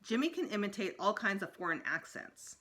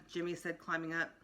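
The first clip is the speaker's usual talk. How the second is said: usual speaking voice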